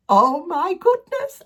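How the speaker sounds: high, loud and slow squeal of delight; excited. Real, not acted. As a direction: burst out in giddy delight. Overjoyed about the Christmas house.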